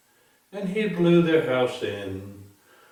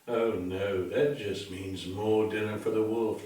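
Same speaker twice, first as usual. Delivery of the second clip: ordinary delivery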